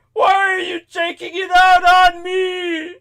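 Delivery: high, slow, loud whine; mock crying wail. Crying cab driver complaining to the caller, mock wail. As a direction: drag it out in a tearful howl. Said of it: "like, crying".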